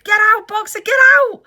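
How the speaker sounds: loud high desperate call; crying out together. Acted. Animals urging Boxer to escape, desperate shout. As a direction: shout it after the van. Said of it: "took up the cry".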